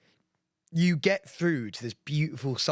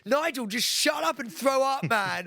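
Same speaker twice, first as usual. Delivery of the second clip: mock shout as Marvin; 11 semitones higher than usual. Marvin yells at groaning Nigel, mock exasperated shout. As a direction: bellow it across the camp. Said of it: "Marvin be like".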